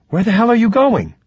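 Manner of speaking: high, indignant demand; alarmed protest. A narrator's reading. Ruby to Stoney as he walks off, high indignant protest. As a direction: pitch it up sharply and challenge him.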